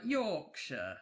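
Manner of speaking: a drawled Yorkshire accent on one word. Shown as a demonstration. Host demonstrates the Yorkshire accent for listeners. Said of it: "that's the kind of accent you need to imagine".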